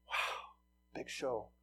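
ordinary delivery

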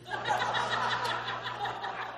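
a high, soft laugh at his own punchline; preacher chuckling at his punchline; high burst of laughter. Real, not acted. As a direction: laugh at the joke you just told.

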